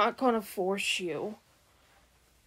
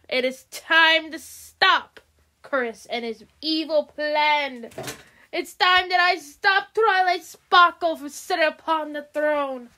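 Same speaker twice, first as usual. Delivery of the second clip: louder, higher scheming villain; gloating villain queen; 5 semitones higher, 5 dB louder than usual. Acted. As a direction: gloat over her plan like a wicked queen. Villain Medusa plots aloud.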